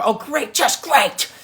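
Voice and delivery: high mock-sarcastic groan. Streamer teasing a French viewer, high mock sarcasm. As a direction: pretend to be let down, hammed up. Reacting to fan from France.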